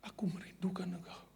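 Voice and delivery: soft whispered tender words; hushed voice of God. Retold or imitated. God softly calls his faithful servant home, hushed whisper. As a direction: breathe it like a gentle calling. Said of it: "Tuhan berkata".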